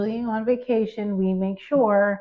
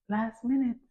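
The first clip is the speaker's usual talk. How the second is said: usual speaking voice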